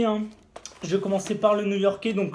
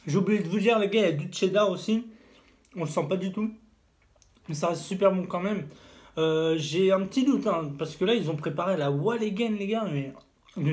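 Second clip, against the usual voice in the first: talking between bites of wrap; muffled words around food; mouth full, chewing. Real, not acted. Seen 0: Wrap held near mouth; bites between words, mouth likely full.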